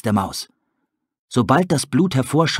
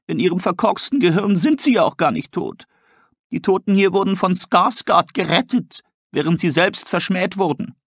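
higher, calm explaining voice; other character; 6 semitones higher than usual. Acted. A second character explaining the wolves to Tully, higher voice. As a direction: explain the wolves' madness with pity.

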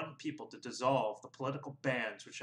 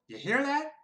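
even higher, loud incredulous ask; amazed repeat; 7 dB louder than usual. A narrator's reading. Registrar repeats to his clerk, louder and amazed. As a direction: push the disbelief further. Sam repeats, more astonished.

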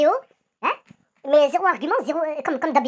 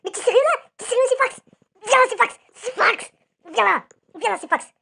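raging call-out; 6 semitones higher than usual. Real, not acted. Calling out rapper [name] in real anger: furious yelling. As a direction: shout him down, furious. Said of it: "Là, j'ai la rage, là".

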